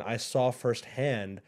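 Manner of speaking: normal talking voice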